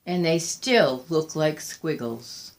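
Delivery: low, soft and defeated; quiet despair. Acted. Old woman at her window, murmuring in low, soft defeat. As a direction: let the hope drain out of the words.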